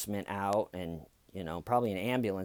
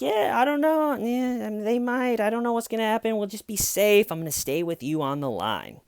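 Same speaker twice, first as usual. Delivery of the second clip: evasive dispatcher; 10 semitones higher than usual. Retold or imitated. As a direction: dodge the question in a high mock-reassuring voice. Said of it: "she was doing like".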